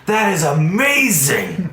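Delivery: high, loud, delighted exclamation; thrilled lord. Acted. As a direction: gush with wild delight. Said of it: "The Lord is like".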